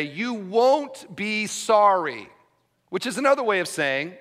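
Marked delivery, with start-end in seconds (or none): 0.0-2.3 "You won't be sorry" said slowly, leaning on "won't"; slow, drawn out. Retold or imitated. Pastor showing his congregation the understatement, slow and knowing. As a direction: stretch "won't" out with a knowing air. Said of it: "but rather you chose to say".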